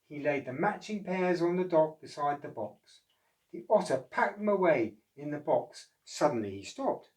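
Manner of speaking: put-on lively read-aloud voice for children; storybook narration. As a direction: tell it like a bedtime picture book.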